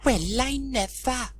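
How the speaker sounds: slow, high fussy lady; exclaiming gossip. Acted. Missus Price spotting the kite, slow high exclaim. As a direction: drawl out the surprise. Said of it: "she exclaimed".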